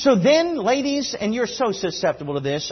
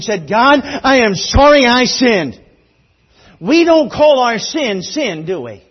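loud, insistent sermon rhythm building to a cry; impassioned, raised preacher's voice; full-force preaching; 6 semitones higher, 10 dB louder than usual. Real, not acted. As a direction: hammer each confession home to the congregation.

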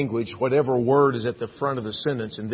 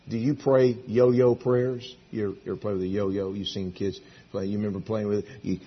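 usual speaking voice

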